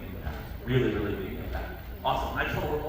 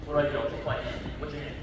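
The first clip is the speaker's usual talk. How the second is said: ordinary talk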